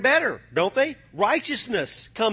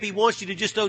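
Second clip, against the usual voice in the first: normal talking voice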